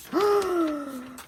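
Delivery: sharp, high gasp of delight. Real, not acted. Sharing new paper with viewers, a high delighted gasp. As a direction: gasp as the page is revealed.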